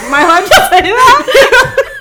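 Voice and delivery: high, loud giggling question; giggly shock; laughing. Real, not acted. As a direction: laugh it out in disbelief. Laughing at own joke.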